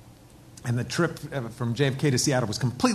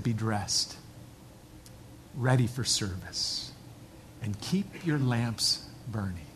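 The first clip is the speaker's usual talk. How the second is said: hushed scripture; 6 semitones lower, 9 dB quieter, slower than usual. Retold or imitated. Quoting Jesus' words.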